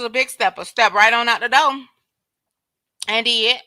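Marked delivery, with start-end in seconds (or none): 3.1-3.6 talking while crunching chips. Real, not acted. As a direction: say it through a mouthful of chips. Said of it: "I'm crunching".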